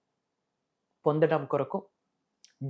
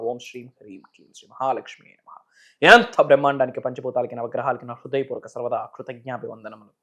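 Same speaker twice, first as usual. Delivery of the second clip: chanted mantras, loud attack fading low; ringing devotional invocation, dropping to a low murmur; opening invocation; 5 semitones lower, 7 dB louder than usual. Real, not acted. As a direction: recite a fervent prayer, surging on each new opening.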